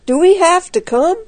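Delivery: high, loud, anxious question; reluctant plea. A narrator's reading. Reluctant boy asks to stay.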